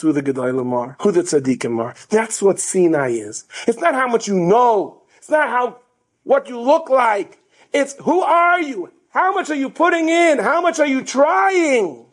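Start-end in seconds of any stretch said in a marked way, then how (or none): 3.7-12.1 preaching the moral. Acted. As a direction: press each question on the listener. Sermon moral delivered fervently.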